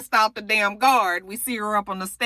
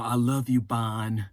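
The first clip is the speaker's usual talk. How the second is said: low, soft lovesick murmur; low mock-tender Frank to Bonnie; 8 semitones lower, 4 dB quieter, slower than usual. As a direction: murmur it like a smitten man. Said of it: "Fine Ass Frank has to go up to her".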